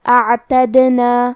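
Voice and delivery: اعتدنا very slow, the دال bounced with an echo; drawn-out اعتدنا, dal bounced; qalqalah recitation. Shown as a demonstration. Teacher demonstrating اعتدنا, bounced dal, slow. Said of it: "ہلاتے ہوئے یہ کلمہ کو پڑھیں گے".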